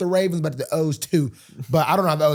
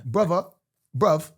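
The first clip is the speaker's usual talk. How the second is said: London slang in a put-on accent. Guest practising London talk for hosts, mock London accent. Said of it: "you're doing be-, when it finishes".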